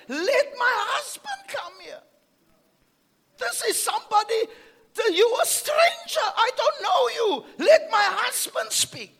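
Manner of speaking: high loud indignant outburst; hammed-up wife refusing the stranger; Eve's refusal. Preacher voicing what Eve should have told the serpent, loud indignant cry. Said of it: "she who should have said".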